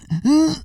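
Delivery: sharp high gasp for air; gasping after a punch to the chest; winded gasp. Retold or imitated.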